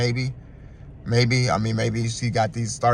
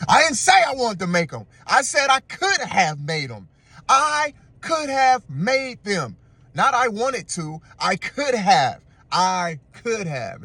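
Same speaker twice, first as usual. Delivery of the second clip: high, slow, over-enunciated protest; mock indignant insistence; 7 semitones higher, slower than usual. Boyfriend correcting girlfriend about the tacos, hammed-up indignant insistence. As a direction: act out a hammed-up, drawn-out objection.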